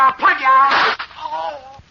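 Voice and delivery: high, loud yell then choked gasp; shouted threat, then cry. Acted. Gangster shouts at his partner in the fight, loud. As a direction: scream the threat as the gun goes off. Gunfight, shot fired.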